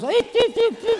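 tiny high mouse-like eeps; husband squeaking back at his nagging wife; squeaky husband. Acted. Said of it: "And the husband goes".

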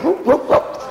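imitated whooping. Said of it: "the one that goes".

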